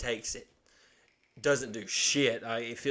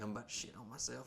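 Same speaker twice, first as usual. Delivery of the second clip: softer, faster urgent mutter; quieter mock mom; 15 dB quieter, faster than usual. Mom in the car repeating herself, softer and faster. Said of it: "she's like".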